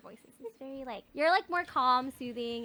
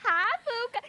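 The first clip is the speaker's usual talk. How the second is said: higher, cooed mock greeting; teasing impression of a friend; imitating a friend; 7 semitones higher than usual. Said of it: "You sound like that in my brain".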